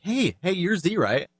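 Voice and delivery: high-pitched mock hail with a questioning finish; imitating attention-getting. Fan stopping the creator at a party, imitated attention-getting. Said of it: "wanted to get my attention".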